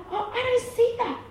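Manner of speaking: high, light brush-off; dismissive father. Acted. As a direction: wave it away in a high voice. Father waving away son's mess.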